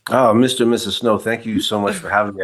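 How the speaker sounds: normal talking voice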